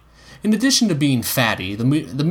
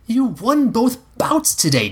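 put-on higher, slow pleading boy; protesting boy; 11 semitones higher, slower than usual. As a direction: urge him like an eager kid. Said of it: "Egg protested".

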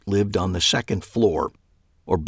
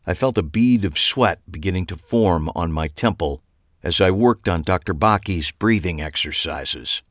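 usual speaking voice

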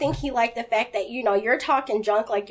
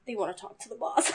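sheepish mumble; 3 semitones lower, 9 dB quieter, faster than usual. Retold or imitated. Boss deflated, shoulders all down.

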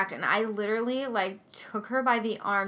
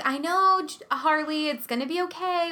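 higher-pitched, softer mommy reassurance; soothing the baby; 5 semitones higher, 3 dB quieter than usual. Retold or imitated. Mom calming hungry screaming baby in the car, high soothing. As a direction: coo comfort to a crying infant.

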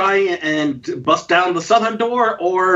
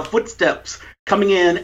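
ordinary delivery

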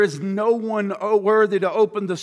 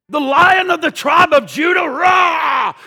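hyped roar; 6 semitones higher, 5 dB louder than usual. Retold or imitated. Hyping lion to his boys.

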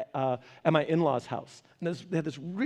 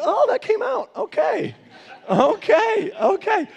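high-pitched flustered backpedal; startled; 14 semitones higher, 5 dB louder, slower than usual. Acted. Speaker caught saying it out loud, flustered. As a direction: blurt it, then high, loud okays. Acts being startled by own words.

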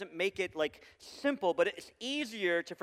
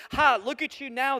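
mocking jeer; 6 semitones higher, 7 dB louder than usual. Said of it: "they yelled at him".

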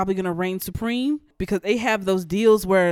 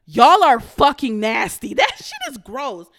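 louder, disgusted outburst; 5 semitones higher, 7 dB louder than usual. Real, not acted. Ranting to listeners about the couple, loud disgust. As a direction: spit out the disgust at them.

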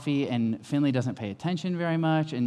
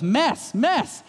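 put-on high, rising little-kid "mess, mess"; dad mimicking his toddler, high squeaky toddler voice; 10 semitones higher, 3 dB louder than usual. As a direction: squeak it like a tiny child pointing at the mess. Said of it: "And Auden just goes".

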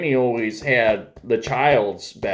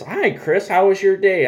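lifted, higher cheerful granny voice; Granny's greeting; 8 semitones higher than usual. Retold or imitated. Grandmother picking up her grandson, high and cheery. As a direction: greet him brightly as a grandmother would.

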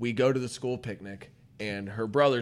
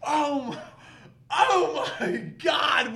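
disgusted shout; slower than usual. Retold or imitated. Kids in class reacting to the girl's sneeze, shrieking. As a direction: shriek it like kids seeing something gross. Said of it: "So we're like".

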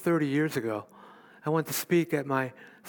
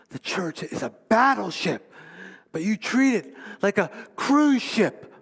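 a hint of a scolding fundamentalist pastor, higher and louder; legalistic pastor scolding; 6 semitones higher, 5 dB louder than usual. Old legalistic pastor lecturing his congregation, mildly scolding. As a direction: lightly mimic a stern preacher's rebuke. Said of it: "they would talk about church".

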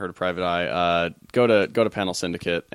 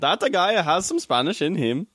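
put-on a would-be Spanish accent that slides into Russian, pitched higher; failed Spanish accent; 8 semitones higher than usual. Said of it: "jumped to Russia".

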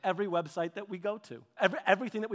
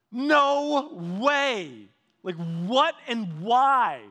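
loud, slowing, baffled exclamation; shocked and baffled, dragging it out; loud, drawn-out stunned exclamation; stunned disbelief; 3 semitones higher, 7 dB louder, much slower than usual. Retold or imitated. Outhouse stacked with magazines.

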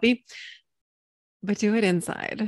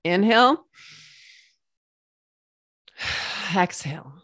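guided breath. Shown as a demonstration. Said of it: "let's all take a breath together".

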